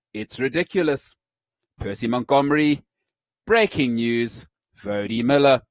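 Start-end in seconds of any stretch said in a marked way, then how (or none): 1.8-5.6 newsreader voice. Shown as a demonstration. Host mocking how newsreaders speak. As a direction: drag out breaking news like a TV anchor. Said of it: "Why do newsreaders speak like this?".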